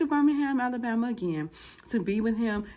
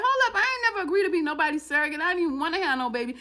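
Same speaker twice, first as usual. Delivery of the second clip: high, fast protest; indignant refusal; 7 semitones higher, faster than usual. Retold or imitated. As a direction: push back in a quick, high-pitched rush. Woman refuses surrogacy demand.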